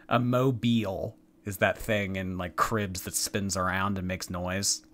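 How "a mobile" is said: "mobile" ending in a long ee, MOH-beel. Shown as a demonstration. Host explains the crib thing, "mobile" as MOH-beel. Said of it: "It's pronounced mobile".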